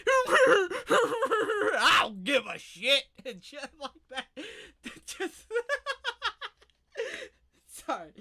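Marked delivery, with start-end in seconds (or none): 0.1-3.0 high, very slow mock blubbering; fake crying. Man fake-crying on the phone to his wife, mock sobbing. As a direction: whine it through fake sobs. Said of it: "fake crying noises".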